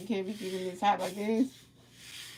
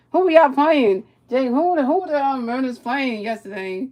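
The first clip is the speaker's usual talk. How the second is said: shouting the question across the room; loud excited shout asking the friend who the Mariners played; loud call to Jake; 4 semitones higher, 12 dB louder than usual. Real, not acted. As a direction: yell it out so Jake hears.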